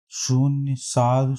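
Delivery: ordinary talk, nothing marked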